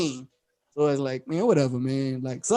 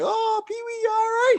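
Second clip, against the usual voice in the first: high, softer mock-concerned voice; imitating concerned girl; 3 dB quieter than usual. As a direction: fuss over him in a high imitation voice. Imitates Precious checking on friend.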